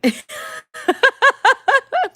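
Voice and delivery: high, loud cackle; burst of laughter. Real, not acted. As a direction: crack up in a long laugh. Reacts to a viewer's comment.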